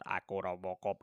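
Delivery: a hint of a stiff robot; lightly voiced Robocop, low and flat-toned. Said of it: "kayak robot". (sedikit suara robot)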